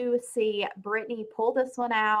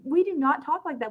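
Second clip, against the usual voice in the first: indignant; 9 semitones higher than usual. Real, not acted. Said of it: "that is why I'm mad".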